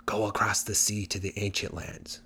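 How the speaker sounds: a soft hushed goblin voice from the bushes; goblin whisper. Acted. Goblin hinting to Hans from a bush: soft hushed voice. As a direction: hush it like a secret hint from hiding. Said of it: "the goblin whispered".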